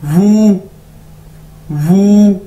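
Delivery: वू repeated loud and clear; model pronunciation. Shown as a demonstration. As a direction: drill वू twice, stressed. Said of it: "तो word है हमारे पास वू".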